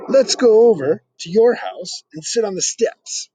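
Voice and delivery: a hint of an eager little boy; lightly voiced boy. Peter inviting Archie to sit on the steps, eager.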